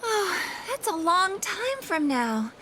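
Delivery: high, slow breath of relief; drawn-out relieved reaction; relieved sigh. Acted. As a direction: let the worry go, slowly. Relieved after learning it is far.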